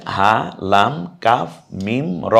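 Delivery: drawn-out Arabic letter names, one by one; Bengali: ধীরে ধীরে অক্ষরের নাম; slow letter names. Shown as a demonstration. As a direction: spell each letter slowly for the class. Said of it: "কি কি অক্ষর আছে?".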